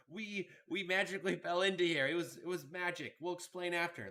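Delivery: high, loud hurried explanation in character; yelling back. Acted. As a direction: call an excuse across the room. Player yells back in character.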